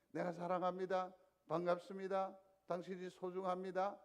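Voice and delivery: beam it out, loud and bright, to the person beside you; warm loud greeting. Shown as a demonstration. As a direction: show your love openly and loudly. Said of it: "어떻게 하면". (활짝 웃으며 크게 건네는 인사)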